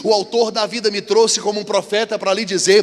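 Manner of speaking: mounting pulpit voice; preaching build. Acted. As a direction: build to the climax.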